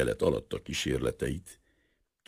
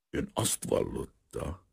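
unfriendly rasp; 7 dB quieter, slower than usual. A narrator's reading. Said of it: "szólt rá barátságtalan, recsegő hangon".

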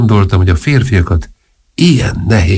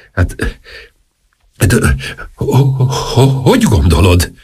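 stammering. Acted. Oliver stammering to Ortrud, flustered and halting. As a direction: trip over the word like a flustered king.

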